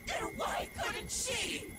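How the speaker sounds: high, drawn-out cry of frustration; anguished protest. Acted. Woman protesting that Yuko failed, anguished.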